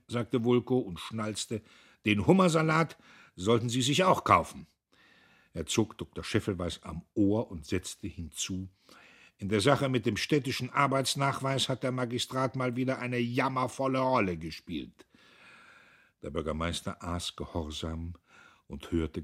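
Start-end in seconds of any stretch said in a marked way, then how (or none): 2.0-4.7 loud, high, jovial needling; overbearing patron, voice raised; blustering tease. A narrator's reading. Blustering president teases the mayor. 9.4-15.1 high, loud, overbearing scolding; domineering official laying blame; blustering president. A narrator's reading. President berates the mayor.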